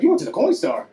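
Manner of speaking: imitating a concerned friend; lightly acted friend, suddenly concerned; changed tone. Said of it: "Whole tone of the conversation changed".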